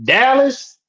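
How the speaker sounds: high-pitched mock Texas twang; Texas accent. Host listing Texas cities for amused co-host, exaggerated Texas accent. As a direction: ham up a bad Texas accent. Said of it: "that was a horrible accent".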